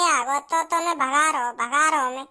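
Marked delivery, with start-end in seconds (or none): none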